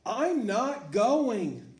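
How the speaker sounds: put-on high, loud, slow refusal of a young girl; child refusing to go to school; stubborn girl. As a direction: dig in your heels like a kid who won't get up. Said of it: "said Sarah".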